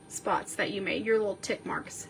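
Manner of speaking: ordinary delivery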